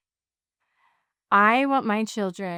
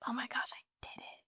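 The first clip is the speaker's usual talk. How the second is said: whispered disbelief; 16 dB quieter, faster than usual. Retold or imitated. Retold moment finishing first 5K.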